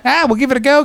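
mock Australian accent. Mocking the guest's line in a high mock Australian accent. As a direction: parrot her in a broad mock Australian accent. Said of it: "Fucking Ockers, mate".

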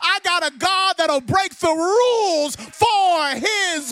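high, loud building to a sung roar; full-force preaching. Acted. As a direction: whoop it up for the glory.

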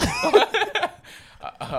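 high, loud burst of laughter. Real, not acted. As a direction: crack up loudly.

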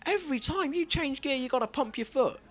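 the crying learner driver complains about the clutch, high and whiny; mock crying rant. High whiny mock sobbing. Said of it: "crying, like".

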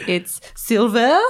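slow, hammed-up French accent; British tourist badly faking French at lost property; mock French accent. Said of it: "in, like, a French accent".

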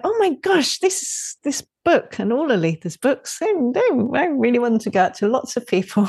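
high, breathless rush of delight; gushing excitement. Retold or imitated. Retelling her own thrill about the books, gushing high. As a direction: burst out as if it just hit you. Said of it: "I'm like".

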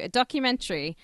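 normal talking voice

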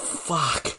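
ordinary delivery

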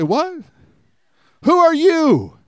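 high-pitched incredulous question; hammed-up "who on earth" astonishment; mock disbelief. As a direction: act out a crowd's baffled reaction, pitch jumping up. Acted stunned reaction to David's challenge.

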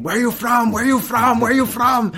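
high, loud, frantic repeated question; panicked screaming. Retold or imitated. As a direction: shout it at him in a panic. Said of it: "he started screaming".

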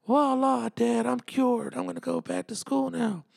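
high-pitched cartoon boy, cured and chipper; put-on kid voice way up high; Bobby. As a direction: announce it like a triumphant little son. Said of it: "Talking like fucking Bobby from fucking".

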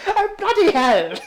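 high, slow mock-regal swear; a royal lightly voiced, startled; the Queen swearing. The Queen reacts to the passport, mock-regal shock. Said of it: "She's like".

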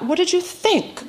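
slow, high-pitched mock incredulity; withering disbelief. A narrator's reading. Grandmother to granddaughter who missed the obvious, withering disbelief. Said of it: "looking at me like I've just failed a test".

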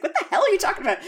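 high-pitched, incredulous mock outburst; comic retold freak-out, voice shooting up. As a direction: imitate someone totally weirded out. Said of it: "freaks the fuck out".

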